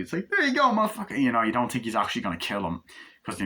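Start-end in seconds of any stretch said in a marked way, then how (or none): 0.3-1.2 much higher, cocky payoff line; high-pitched swagger; actor impression. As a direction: hand over the cash with swagger. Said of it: "doing the impressions of the, uh, actors".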